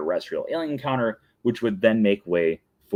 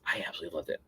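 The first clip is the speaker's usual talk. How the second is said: soft echo; 4 semitones lower, 13 dB quieter, much faster than usual. Real, not acted. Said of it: "And I absolutely loved it".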